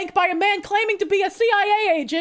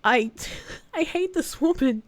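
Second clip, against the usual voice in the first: normal talking voice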